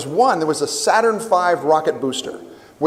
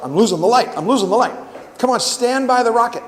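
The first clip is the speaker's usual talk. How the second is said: a little ticked; 7 semitones higher than usual. Retold or imitated. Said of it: "I was getting a little ticked".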